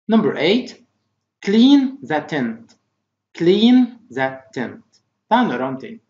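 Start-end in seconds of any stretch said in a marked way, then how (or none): 1.4-4.8 very slow, separated words; slow model reading. Shown as a demonstration. Teacher reading vocabulary for pupils to repeat, slowly. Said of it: "Try to repeat after me".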